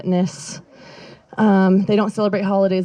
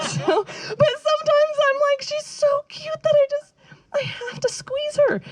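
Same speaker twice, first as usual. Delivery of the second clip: gushing over cute cat. Retold or imitated. Confessing how she squeezes her cat, high gushing coo.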